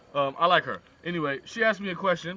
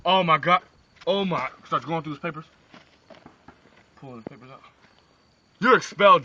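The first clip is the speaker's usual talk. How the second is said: furious principal; 4 dB louder than usual. Acted. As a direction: throw him out of school. Principal expels John.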